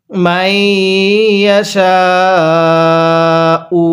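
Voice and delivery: মাইয়াশাউ stretched very slowly; long drawn recitation. Shown as a demonstration. Teacher models the long madd for students. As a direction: draw out the final vowel. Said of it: "আমার সাথে পড়ুন".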